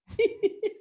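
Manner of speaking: a short, high burst of real laughter; a quick amused laugh. Real, not acted. Laughs about husbands unseen.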